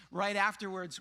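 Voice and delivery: ordinary delivery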